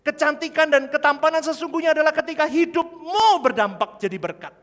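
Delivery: loud high preacher's cry; fiery sermon shout; preaching at full force. Real, not acted. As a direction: thunder it out to the church.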